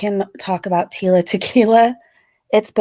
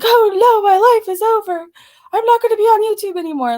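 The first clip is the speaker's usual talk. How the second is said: much higher, louder mock wail, hammed-up; mock despair; 10 semitones higher, 4 dB louder than usual. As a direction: ham up a fake meltdown, faster at the end. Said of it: "think I'm gonna cry and be like".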